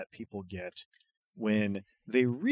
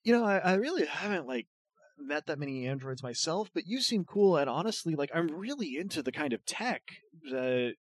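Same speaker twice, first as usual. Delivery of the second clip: higher, quick, rambling; babbling nerdy student; 5 semitones higher, 4 dB quieter, faster than usual. Acted. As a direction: gush at the android nervously. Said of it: "They're like".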